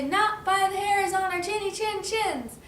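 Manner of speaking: high, loud sing-song chant; defiant storybook pigs. Acted. Pigs answer the wolf, high chanted defiance. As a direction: chant the refusal in a piggy voice.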